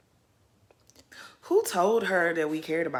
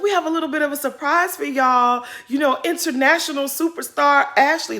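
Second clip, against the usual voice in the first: host announcement; 8 semitones higher, 7 dB louder than usual. Retold or imitated. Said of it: "And when she was like".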